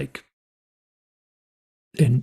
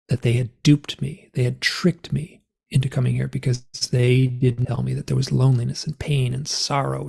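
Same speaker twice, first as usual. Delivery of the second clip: ordinary delivery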